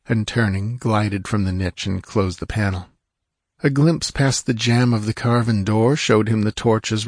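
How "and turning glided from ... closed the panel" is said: usual speaking voice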